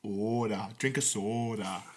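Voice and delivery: put-on slow, rounded Minnesota accent vowels, held for the joke; mock Minnesota accent. Riffing for podcast friends in a slow mock Minnesota accent. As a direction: drawl out soda like a Minnesotan, slowly. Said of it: "like, a Minnesota accent?".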